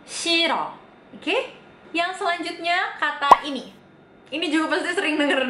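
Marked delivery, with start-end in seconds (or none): 0.1-0.8 Shiro said higher and alone, as "siro"; modelled shiro. Shown as a demonstration. Teacher to viewers, repeating Shiro as model. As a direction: hold up Shiro for students to copy. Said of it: "dibacanya shiro".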